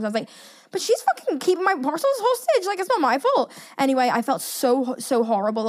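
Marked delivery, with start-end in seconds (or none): 0.7-3.5 high, whiny protest to her mum; indignant protest to mum. Retold or imitated. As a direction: plead that it is not her fault.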